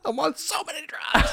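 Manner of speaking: very high, strained raver cry; hammed-up high-pitched raver; mock drugged raver. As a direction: wail it like a blissed-out partygoer. Mimicking ravers at the party.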